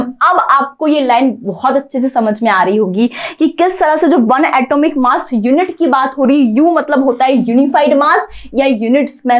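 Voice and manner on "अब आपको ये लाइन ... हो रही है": normal talking voice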